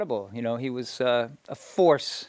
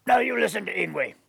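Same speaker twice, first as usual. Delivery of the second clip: put-on higher, commanding old grandfather addressing parents; Yngwie's elder voice; 5 semitones higher, faster than usual. Elder lecturing the reluctant parents, higher commanding grandfather voice. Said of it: "I would hear Yngwie say".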